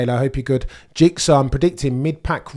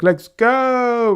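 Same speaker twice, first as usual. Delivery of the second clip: much higher, louder let's go; hype shout; 13 semitones higher, 7 dB louder than usual. Real, not acted. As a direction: fire up the chat before the race. Race about to start, hype.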